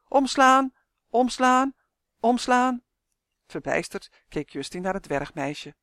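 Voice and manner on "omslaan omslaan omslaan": loud, high, insistent commands; repeated calling out. A narrator's reading. Malien keeps ordering the book to turn, calling loudly. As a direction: call each order on one raised note. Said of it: "riep voortdurend".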